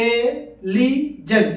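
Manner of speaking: Intelligent very slow, in four separate pieces; syllable by syllable. Shown as a demonstration. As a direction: pause after each syllable of intelligent: in, te, li, jent. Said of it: "चार बार पे बोला ना".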